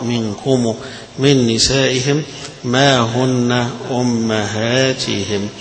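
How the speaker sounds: منكم ending minkumū and أمهاتهم ending -himū; reading with sila. Shown as a demonstration. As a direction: join a long u onto each final m. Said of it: "اللي هيقراها بالصلة".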